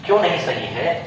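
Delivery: usual speaking voice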